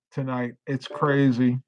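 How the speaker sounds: ordinary talk, nothing marked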